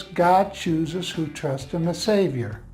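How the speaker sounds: normal talking voice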